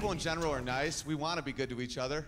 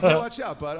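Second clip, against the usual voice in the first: louder, sharp warning call; 10 dB louder than usual. Acted. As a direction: bark it at the stranger. Bump in person, yelled.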